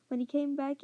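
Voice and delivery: ordinary delivery, nothing marked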